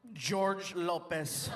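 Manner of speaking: writing aloud. Acted. Comedian signs a fake name, slow drawn-out write-along voice. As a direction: stretch the name as if signing it.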